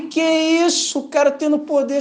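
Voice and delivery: preacher retelling his own shock at reading scripture, high amazed voice; retold amazement. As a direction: gasp it out as if you can't believe it.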